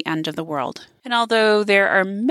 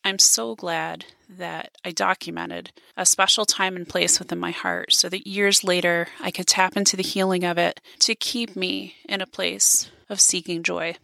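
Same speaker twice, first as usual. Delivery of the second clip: ordinary delivery, nothing marked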